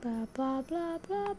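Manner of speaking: ordinary talk, nothing marked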